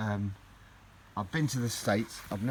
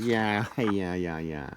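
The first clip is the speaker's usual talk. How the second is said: imitating Louis; 4 dB louder, slower than usual. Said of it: "He goes".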